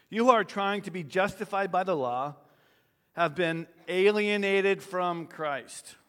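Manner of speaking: loud fervent scripture reading; forceful preaching. Acted. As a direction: proclaim it with force.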